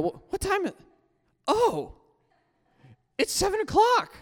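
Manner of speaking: put-on high kid voice of his fifth grade self; a boy's high panicked voice; younger self voice. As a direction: pitch up like a small boy realising he's late. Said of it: "I'm trying to do my voice back then".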